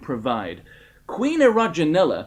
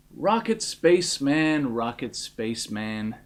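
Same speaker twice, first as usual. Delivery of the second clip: soft and calming, fading lower and quieter; soothing; 5 semitones lower, 5 dB quieter than usual. A narrator's reading. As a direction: soothe him like a worried child. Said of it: "said the president in a soothing tone".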